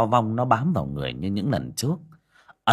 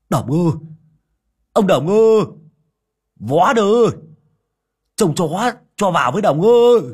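high, loud pleading call through the gate; 11 semitones higher, 10 dB louder than usual. A narrator's reading. Vó clings to the gate calling Đồng to hold the dog. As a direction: call for help across the yard. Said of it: "hai tay đu vào song cổng mà gọi".

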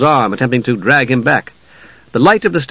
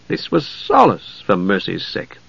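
usual speaking voice

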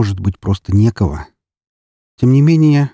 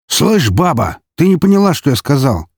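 barking shout; 6 semitones higher than usual. Acted. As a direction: snap rudely at the woman. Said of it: "рявкнул охранник".

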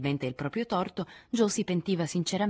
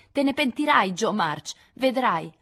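Amy urla forte e acuta, minacciosa; grido minaccioso; 6 semitones higher, 7 dB louder, slower than usual. A narrator's reading. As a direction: minacciala gridando dalle scale. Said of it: "Amy gridò minacciosa". (Amy minaccia Jo che esce, grido forte)

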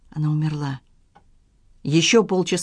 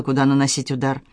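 ordinary delivery

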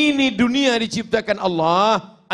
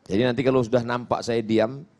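ordinary talk, nothing marked

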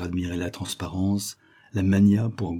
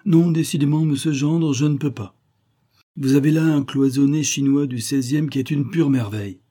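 higher-pitched, emphatic refusal; scrupulous client, protesting; 6 semitones higher, 4 dB louder than usual. Acted. Client tells the dealer he cannot accept, protesting earnestly. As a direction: protest earnestly in a raised character voice.

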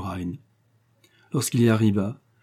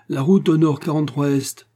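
louder, higher barked command to the officer; curt order; 5 semitones higher, 5 dB louder than usual. A narrator's reading. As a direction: give the course like a captain's order. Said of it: "ordonna le baron à l'officier de quart". (ordre sec et plus fort du baron à l'officier)

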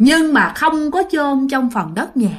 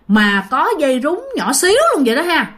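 usual speaking voice

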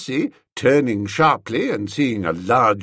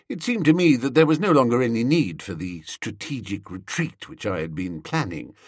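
usual speaking voice

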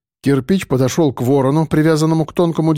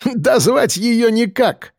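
high, slow cackling taunt; guffawing; 8 semitones higher, slower than usual. A narrator's reading. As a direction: laugh it out, mocking her. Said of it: "захохотал третий".

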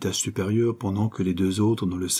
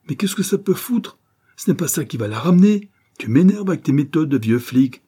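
higher, louder angry protest; irritated outburst; 6 semitones higher, 5 dB louder than usual. A narrator's reading. Fred lashing out at the old cop's questioning, irritated. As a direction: throw the question back in exasperation.

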